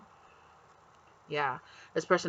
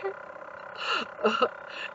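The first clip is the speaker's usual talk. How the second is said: breathy laugh; embarrassed giggle; 11 semitones higher, 5 dB quieter than usual. Real, not acted. Laughs at own accent.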